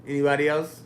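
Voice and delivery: loud call to the room. Real, not acted. Asking chat what changed, calling out loudly.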